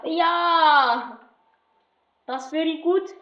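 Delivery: a deliberately bad German accent, lightly done; mock German coach with a clumsy German accent; jokey German accent, barely put on. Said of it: "Je fais l'accent allemand très, très mal".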